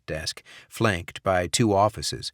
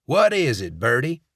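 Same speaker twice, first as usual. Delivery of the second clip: gruff voice; 5 semitones higher, 5 dB louder than usual. A narrator's reading. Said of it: "a man asked in a gruff voice".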